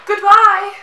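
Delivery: high, loud princess goodbye; sing-song cartoon princess farewell; Disney princess voice. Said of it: "I sound like a Disney princess".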